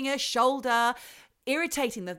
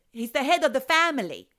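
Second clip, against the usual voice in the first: put-on insistent Italian old man, louder; light Italian accent; 4 semitones higher, 6 dB louder than usual. Ghost insisting on the grandson in an Italian accent. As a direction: press the point in an Italian accent.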